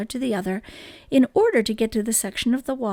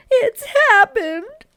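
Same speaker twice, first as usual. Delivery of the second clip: much higher and louder, slow; alarmed cry; 12 semitones higher, 8 dB louder, slower than usual. A narrator's reading. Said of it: "she cried".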